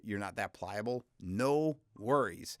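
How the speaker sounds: usual speaking voice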